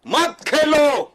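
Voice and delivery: exasperated shouting. Real, not acted. Guest overriding the anchor's plea to play cricket, exasperated shouting. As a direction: cut in and hammer each repeat at high pitch.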